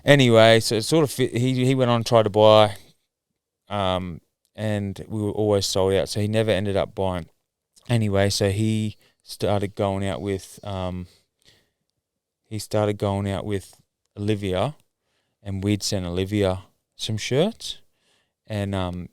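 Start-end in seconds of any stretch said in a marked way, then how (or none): none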